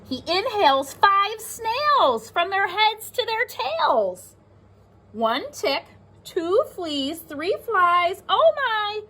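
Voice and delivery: lively storybook read-aloud; reading to children. Acted. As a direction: read it like a picture book to kids. Reads the book aloud.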